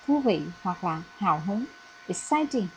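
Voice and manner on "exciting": Exciting with the weight on "ci"; stress on second syllable. Shown as a demonstration. Teacher modelling Exciting for the class, stressing the second syllable. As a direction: lean into the middle of Exciting, then drop. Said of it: "trọng âm nằm ở vần thứ hai".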